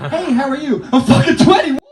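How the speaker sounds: put-on high, loud drunken yell; drunk backpacker. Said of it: "some drunk backpacker in Plaza Real".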